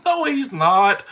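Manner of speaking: high, loud dismissal; imitated amused wife. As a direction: imitate a wife laughing off the worry. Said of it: "My wife starts laughing".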